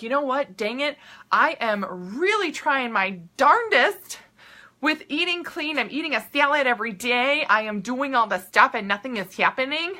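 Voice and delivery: exasperated dieter with Minnesota vowels; Minnesota accent. Retold or imitated. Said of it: "my Minnesota accent there came out".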